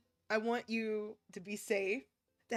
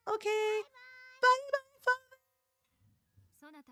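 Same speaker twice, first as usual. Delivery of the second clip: put-on high, slow, louder little boy calling out; child saying goodbye; 3 dB louder, slower than usual. Child bidding his far-off father goodbye, high slow call. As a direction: wave goodbye to the sky like a small child.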